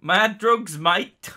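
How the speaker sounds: loud, high mock London accent. Reading a viewer's message aloud, London accent. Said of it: "like, a London accent".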